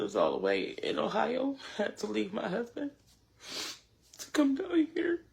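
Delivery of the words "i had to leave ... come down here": breaking into a whispered sob; words choked off by real tears; crying. Real, not acted. Mother far from her husband, crying through it. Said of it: "I'm sorry".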